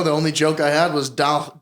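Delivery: ordinary talk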